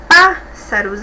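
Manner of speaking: "pássaros" with PÁS held and set apart; stress first syllable. Shown as a demonstration. Teacher showing the antepenúltima is strongest, stressing PÁS in "pássaros". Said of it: "que é a mais forte".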